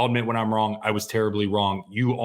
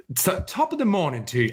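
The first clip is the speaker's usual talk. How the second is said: higher, badly faked Irish lilt; bad Irish accent; 7 semitones higher than usual. Co-host botches the requested accent, landing in hammed-up Irish. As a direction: attempt a cartoon Irish greeting. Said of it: "going after your Lucky Charms".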